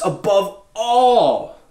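slow, weighty above all; slow emphatic scripture quote; solemn scripture. Acted. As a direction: read it slowly with reverence. Quoting scripture slowly.

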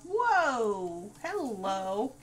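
loud delighted exclamation; excited whoa. Real, not acted. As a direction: burst out in surprise. Excited reaction to sim.

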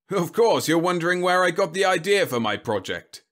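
high, loud, blustering General; pompous military man holding forth; self-important General. Acted. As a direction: boom it out, pitched well up. Voices the General.

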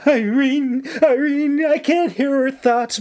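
high, frantic cry; narrator's acted panic, pitched high; panicked shriek. A narrator's reading. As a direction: shriek in distress for a friend. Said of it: "May shrieked".

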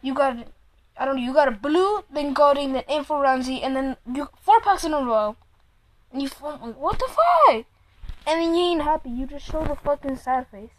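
normal talking voice

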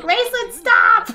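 very high, loud mock kid yell; hammed-up high-pitched child's shout; imitating excited kids. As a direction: squeal it like the kids scolding the dog. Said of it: "They're like".

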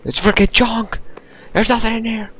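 high-pitched exasperated complaint; frustrated whine. Real, not acted. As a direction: whine at the useless junk. Frustrated at empty containers.

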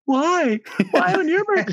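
very high-pitched imitation of tearful wailing; mock sobbing. Man imitating his fiancée at the proposal, mock sobbing. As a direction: squeak it out like someone sobbing. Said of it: "crying, like, sobbing".

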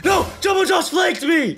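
very high-pitched yelp of outrage; alarmed shout. Real, not acted. Player caught by a monster, high outraged shout. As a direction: cry out as you get ambushed.

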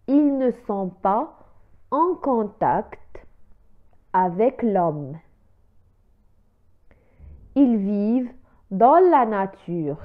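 slow, word by word, like dictation; slow model reading. Shown as a demonstration. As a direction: spell it out slowly for the learners. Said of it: "إذن لنعيد القراءة".

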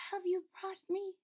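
put-on high, faint little girl voice; weak sick princess. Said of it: "her voice very weak".

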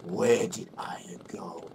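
slow, hushed whisper. Acted. Player voicing an in-game line, whispered. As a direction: whisper it slowly.

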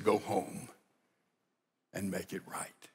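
wife recalls mother's words to a friend, hushed; hushed recollection. Retold or imitated. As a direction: remember mother's order tenderly. Daughter fondly recalls mother's words.

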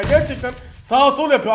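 ordinary delivery

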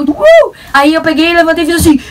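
ordinary talk, nothing marked

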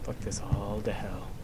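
low whispered curse; scared whisper. Real, not acted. Frightened player alone in the dark, whispered cursing. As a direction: curse under your breath. Scared, muttering under breath.

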